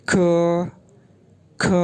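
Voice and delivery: ख said with a puff of air; ख, aspirated k and a short vowel; ख like the Mandarin syllable ke; pinyin ke. Shown as a demonstration. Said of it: "के की sound यूँ होगी ख".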